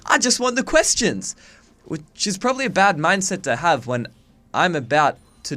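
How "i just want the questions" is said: high, loud mock-indignant retort; comic yell of exasperation; exasperated outburst. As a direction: snap it back, high and loud. Retorting to websites full of answers.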